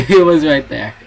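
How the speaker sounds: high, very loud, through laughter; shocked laughing yell. Real, not acted. Player after seeing Slendy right there, laughing shout. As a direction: yell it while laughing from the scare.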